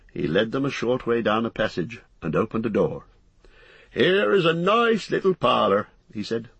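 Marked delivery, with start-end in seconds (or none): 3.9-5.9 high-pitched, hearty landlord's greeting; bustling innkeeper. Acted. Landlord to a newly arrived hobbit, bustling welcome. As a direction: greet the guest in a high, lively character voice.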